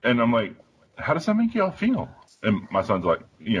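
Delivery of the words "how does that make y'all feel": high, delicate parent question; imitated tiptoeing concern, pitched up; retold careful probing. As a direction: ask it in a much higher voice.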